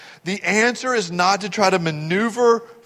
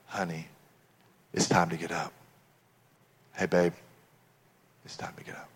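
very soft, low murmur fading to a whisper; gentle waking; 11 semitones lower, 12 dB quieter, faster than usual. Acted. Dad waking daughter who dozes in service, hushed and tender. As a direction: rouse a dozing child tenderly. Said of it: "me going".

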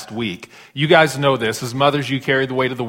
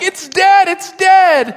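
put-on wailing little girl; 9 dB louder, much slower than usual. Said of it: "she turns around".

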